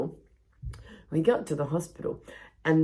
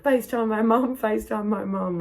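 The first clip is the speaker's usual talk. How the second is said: mock drunk demand; 7 semitones higher, 4 dB louder than usual.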